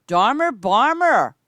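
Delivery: calling out. Real, not acted. Streamer greets a returning player by name, calling out loudly. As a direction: stretch the name out like hailing a friend across the field.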